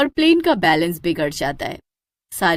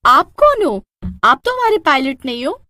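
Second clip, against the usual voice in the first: higher, louder startled question; alarmed; 7 semitones higher, 5 dB louder than usual. Acted. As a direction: confront the stranger, shocked. Hostess confronts stranger pilot.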